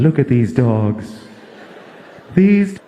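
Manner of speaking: slow, somber pleading announcer; mock-solemn slow charity voiceover. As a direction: plead for donations like a sad TV ad. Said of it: "the voice comes on".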